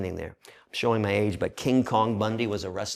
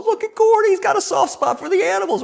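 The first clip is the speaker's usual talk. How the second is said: much higher and louder, amazed; delighted inner voice; 11 dB louder than usual. Retold or imitated. Thinking about the tough inmate, delighted high gush. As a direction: gush to yourself in disbelief. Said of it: "in my head, I'm going".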